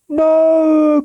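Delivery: high, loud cry of no; cried out. A narrator's reading. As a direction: yell it in alarm. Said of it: "cried Dracover".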